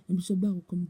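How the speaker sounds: usual speaking voice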